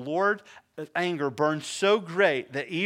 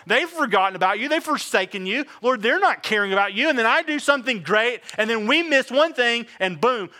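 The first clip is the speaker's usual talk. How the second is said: louder and higher indignant protest to God; a king's indignant outcry, higher and louder; indignant David; 8 semitones higher, 8 dB louder than usual. Retold or imitated. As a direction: confront God, hurt and outraged. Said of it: "he's mad because he's like".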